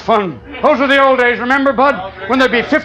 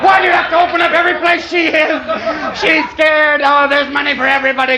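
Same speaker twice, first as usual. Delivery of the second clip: impassioned shout; 5 semitones higher than usual. Real, not acted. Comic begging Bud to make peace with Mitzi, shouting. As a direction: plead at full voice for peace.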